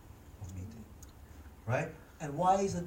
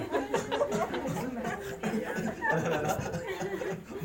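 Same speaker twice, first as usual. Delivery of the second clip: real laughter after the joke; long laugh; 6 semitones higher, 4 dB quieter than usual. Real, not acted. Pastor laughing at his own outlet joke, long real laugh. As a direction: let a long laugh roll out.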